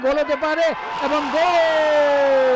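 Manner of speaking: long drawn-out goal call. Real, not acted. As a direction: hold the goal word long. Sixth goal.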